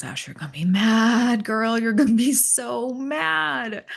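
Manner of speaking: singsong tease, drawn out slowly on the last line; husband voice. Retold or imitated. Husband teasing wife she'll be mad.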